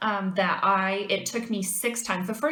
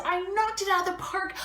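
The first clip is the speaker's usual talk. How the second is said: much higher, proud and worked up; pitched way up with pride; excited boast; 11 semitones higher than usual. Retold or imitated. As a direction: brag to mom that you nailed it. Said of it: "I called my mother and I said".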